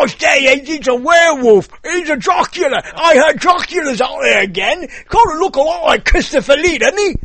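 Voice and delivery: high, loud hammed-up English villager; over-the-top English horror-film accent; Hammer Films English accent. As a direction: exclaim like a B-horror English local. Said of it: "you know what that is? Hammer Films".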